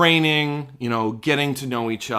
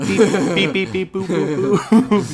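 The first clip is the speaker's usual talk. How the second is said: droid beeps; 5 semitones higher than usual. Pretend.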